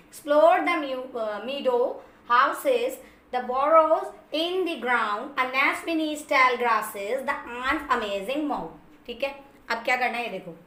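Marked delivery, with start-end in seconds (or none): 0.1-9.1 reciting a poem stanza very slowly, line by line; slow poem reading. Acted. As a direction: read each line slowly for students. Reads second poem stanza. (कविता की पंक्तियाँ बहुत धीरे पढ़ना)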